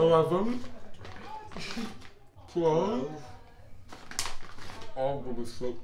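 cheeks stuffed full. Real, not acted. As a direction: mouth crammed with sweets. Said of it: "We can't understand you anyway".

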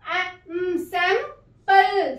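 Sample split slowly as सैं-पल; slow sounding out. Shown as a demonstration. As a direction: draw sample out syllable by syllable. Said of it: "अपनी short sound देगा". (Sample को धीरे धीरे टुकड़ों में बोलिए)

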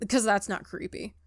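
normal talking voice

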